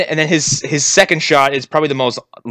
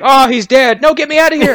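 higher, louder hammed-up panic; mock panicked crash victim; mock screaming deputy; 12 semitones higher, 5 dB louder than usual. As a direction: yell it like a trapped driver, half joking. Acted bystanders and screaming deputy.

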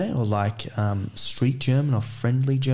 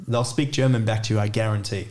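ordinary talk, nothing marked

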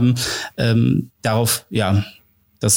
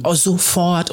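higher, mock-eager follower reply; eager retold replies; 6 semitones higher, much slower than usual. Followers answering her story post, mildly excited. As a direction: imitate keen fans answering.

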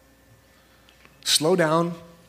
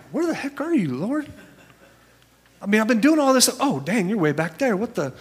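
high, frantic mock call to God; frantic believer; 7 semitones higher than usual. Busy churchgoer calling out to a God left behind, mock frantic. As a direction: play the busy believer lost and panicking. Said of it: "we're like".

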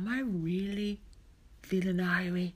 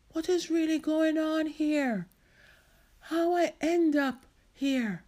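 high, raised-voice confusion; bewildered; 10 semitones higher, 5 dB louder than usual. Acted. Marcia waking up on her couch, bewildered. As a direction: demand an explanation, stretching the last question.

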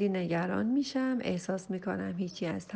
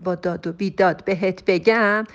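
loud, raised voice for the shouting version; loud demo; 10 dB louder than usual. Shown as a demonstration. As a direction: deliberately shout it to show the effect. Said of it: "با صدای بلند". (با صدای بلند و داد)

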